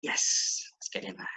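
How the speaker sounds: hushed, voiceless little "yes"; whispered cheer. Real, not acted. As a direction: whisper a private celebration. Mock private cheer after deciding.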